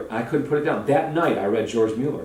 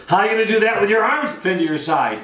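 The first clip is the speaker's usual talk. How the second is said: high, hollered challenge; mock taunt; 8 semitones higher than usual. Charlie taunts the man he has pinned, mock taunt. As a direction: imitate an overbearing showman taunting his captive.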